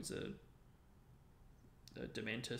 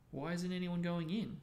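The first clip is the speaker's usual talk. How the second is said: lightly voiced puzzled question, pitched up; curious; 6 semitones higher, 4 dB louder than usual. A narrator's reading. Boy asking why the crowd is stuck outside, curious. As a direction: ask it with open curiosity, a bit higher. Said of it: "said Ron curiously".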